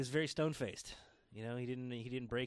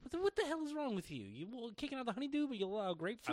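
mock outrage; 10 semitones higher than usual. Mock outrage over fruit.

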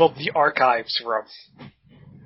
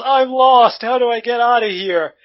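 high, raised-voice cry for help; loud yell; 9 semitones higher, 4 dB louder than usual. A narrator's reading. Lost character loudly yelling for a way out, high-pitched yell. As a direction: call out loudly, higher and drawn up. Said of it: "loudly yells".

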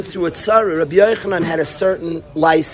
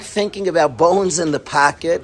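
ordinary talk

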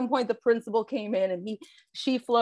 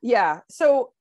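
ordinary talk

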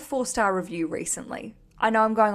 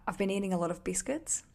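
ordinary delivery

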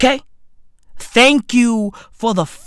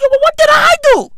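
high-pitched, rapid incredulous plea; squeaky-high retold disbelief; bewildered protest; 4 dB louder, faster than usual. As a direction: protest your innocence way up high. Retelling his bewildered protest to the bird.

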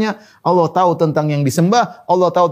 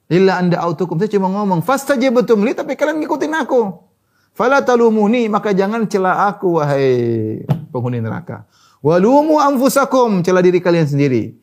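raised, forceful voice for Satan's speech; impassioned preaching; 5 semitones higher than usual. Acted. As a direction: preach Satan's rebuke to the damned.

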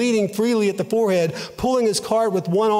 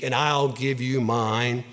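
low, slow and tender; with pity; 6 semitones lower, 4 dB quieter than usual. A narrator's reading. Rag man offering the bleeding child his own cloth, low and tender. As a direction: speak slowly and low, full of pity. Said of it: "looked upon this child with pity".

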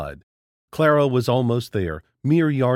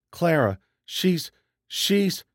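higher, halting, slow and broken; can't get the words out; struggling for words; 6 semitones higher, slower than usual. A narrator's reading. Jenna Lee trying to say what happened to Clara, halting. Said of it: "Jenna struggled for the words".

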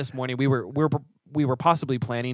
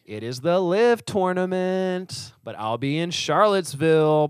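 dramatic announcement; 6 semitones higher, 5 dB louder, slower than usual. Pretend. Mock grand event announcement.

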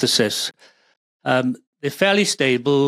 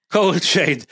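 Cold chain said through a laugh, a bit higher; chuckling while repeating it; 4 semitones higher than usual. Real, not acted. Laughing at the accent joke.

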